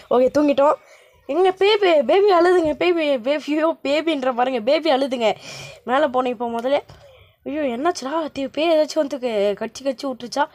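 ordinary talk, nothing marked

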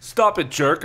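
loud, high, annoyed yell; screamed. A narrator's reading. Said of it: "I screamed".

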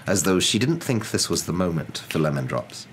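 usual speaking voice

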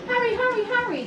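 high, loud teasing urging; gleeful taunting. A narrator's reading. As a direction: goad the one behind, without real laughter. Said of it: "laughed Gordon in front".

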